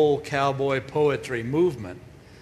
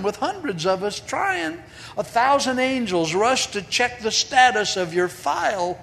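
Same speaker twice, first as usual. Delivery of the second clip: higher, amused, patient saint voice; kindly saint; 6 semitones higher than usual. Acted. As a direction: answer like a gently teasing Saint Peter. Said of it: "and he said".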